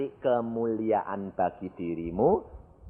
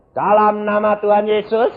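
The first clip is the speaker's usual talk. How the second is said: raised, louder rebuke of a demon; exorcism command; 10 semitones higher, 9 dB louder than usual. Retold or imitated. Preacher reenacts confronting the devil, loud commanding voice. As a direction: command the demon with authority, pitch lifted.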